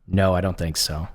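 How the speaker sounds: ordinary delivery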